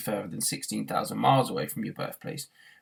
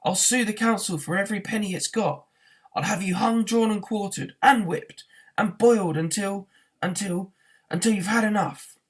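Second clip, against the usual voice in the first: high, loud furious tirade; raging threats at the council men; furious rant; 8 semitones higher, 5 dB louder than usual. A narrator's reading. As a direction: rant faster and louder with rage. Arthur raging at bulldozers.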